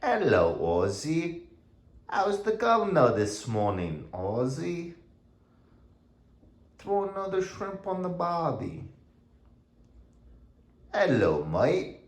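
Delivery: high-pitched mock Australian; butchered Australian accent. Owner greets dog Aussie as it should be said, mock Australian accent. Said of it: "represent an Australian accent".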